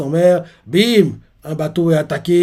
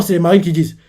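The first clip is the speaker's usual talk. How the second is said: usual speaking voice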